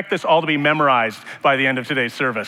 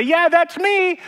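much higher squeaky mock confession; sheepish mock admission; 5 dB louder, slower than usual. Pastor playing himself admitting he is the liar, squeaky high. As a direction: squeak it out like a caught kid. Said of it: "And I say".

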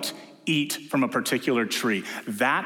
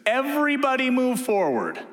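infantryman shouting; 11 semitones higher than usual. Retold or imitated. Infantryman passing the order on, shouting high. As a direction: yell it down the line. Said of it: "a lower level infantryman says".

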